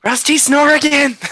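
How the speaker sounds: high loud shout; loud call. Real, not acted. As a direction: call out across the table. Calling out at table.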